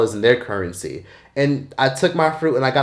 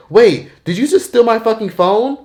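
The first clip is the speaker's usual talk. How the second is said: much higher, louder accusing yell; fake accusation; 10 semitones higher, 5 dB louder than usual. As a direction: accuse the tourist loudly to pressure him. Scammer fakes accusation.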